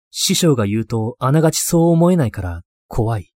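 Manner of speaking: plain reading voice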